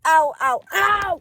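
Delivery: sharp cries of real pain; yelping ow from a caught finger. Real, not acted. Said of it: "that hurted".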